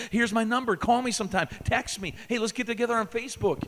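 lightly voiced eager teen girl. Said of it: "our girls are so anxious".

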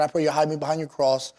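ordinary talk

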